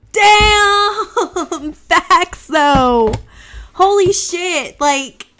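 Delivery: loud, high, laughing outburst; excited shout. Real, not acted. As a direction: burst out in stunned disbelief. Reacting stunned to the video.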